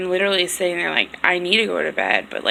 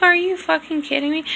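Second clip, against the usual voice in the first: incredulous outburst; 9 semitones higher than usual. Retold or imitated. Disbelief at being called high.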